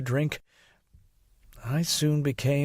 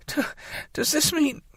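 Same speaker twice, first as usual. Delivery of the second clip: whimpering; 10 semitones higher than usual. Acted. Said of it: "I whimpered".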